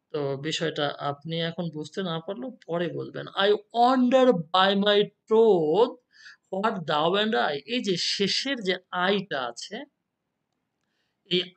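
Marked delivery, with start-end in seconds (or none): 3.3-7.6 slow, loud opening on the verse line; poem read aloud. Shown as a demonstration. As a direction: read the verse out slowly and emphatically.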